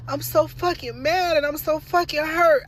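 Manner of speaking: normal talking voice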